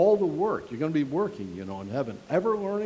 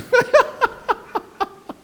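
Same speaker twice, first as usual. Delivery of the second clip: loud high burst of laughter; 7 dB louder than usual. Real, not acted. Laughing at own story.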